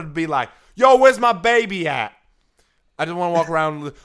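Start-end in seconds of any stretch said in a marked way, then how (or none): 0.8-2.1 loud, high, hammed-up demand; sassy angry-mother caricature. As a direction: shout it as an over-the-top sassy mother. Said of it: "particular type of voice".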